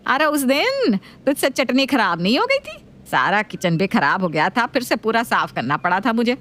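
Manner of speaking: nagging mother-in-law voice. Acted.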